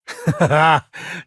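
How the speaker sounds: a loud, hearty laugh. Real, not acted. Companion impressed by the captain's stunt, laughing loudly.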